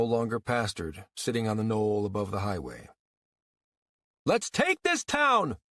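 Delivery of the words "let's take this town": high, slow rallying holler; rally the troops like a general. Acted. Cantwell hollers to his followers, commanding a charge. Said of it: "he hollered".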